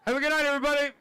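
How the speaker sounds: high, loud goodnight to the room; loud sign-off. Real, not acted. Comic closing the set, shouting goodnight high and loud. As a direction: send them off loud and high. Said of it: "signing off the set".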